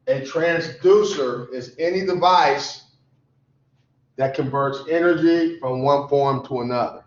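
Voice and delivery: measured, phrase by phrase for note-taking; slow dictation. Shown as a demonstration. Teacher repeats a definition so students can write it down, slow dictation. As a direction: say it slowly, pausing after "device". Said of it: "I'm gonna say it slowly".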